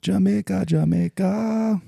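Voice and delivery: high, drawn-out Jamaican lilt on Jamaica; Jamaican accent. Retold or imitated. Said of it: "her Jamaican accent".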